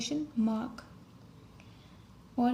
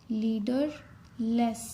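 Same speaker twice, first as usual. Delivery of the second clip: slow dictation; much slower than usual. Shown as a demonstration. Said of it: "dictating a shorthand word".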